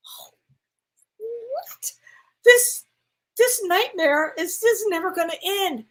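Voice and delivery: high-pitched, disbelieving outburst; retold dismay. Patient hearing of a bacterial infection, dismayed.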